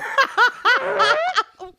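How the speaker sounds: loud high laugh bursting out; burst of laughter. Real, not acted. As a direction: crack up loudly. Friend laughs at story.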